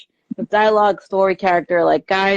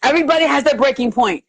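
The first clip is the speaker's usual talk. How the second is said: rising, urgent plea to calm down; urgent warning; 6 semitones higher than usual. Retold or imitated. Friend warns Madeline urgently, climbing higher. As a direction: warn Madeline he's about to snap. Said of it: "I'm going".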